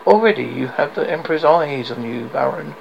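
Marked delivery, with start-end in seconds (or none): none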